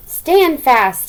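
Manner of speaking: loud call from above. Acted. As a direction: call it down to the wee folk. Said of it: "Tim called down".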